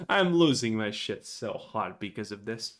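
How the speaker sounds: normal talking voice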